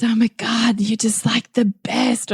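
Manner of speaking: lightly over-the-top adoring praise; hammed-up praise. Mother teasing her son with affection, lightly hammed-up. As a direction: ham up the affection for the kid. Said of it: "ham that up".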